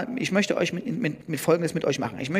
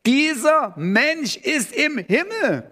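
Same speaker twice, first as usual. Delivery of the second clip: dieser Mensch ist im Himmel, high, loud and slow; slow emphatic stress; 9 semitones higher, 3 dB louder, slower than usual. Shown as a demonstration. Preacher drives home where Jesus is, slow and loud. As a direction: lean on dieser and drag the line out. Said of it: "die Betonung liegt auf".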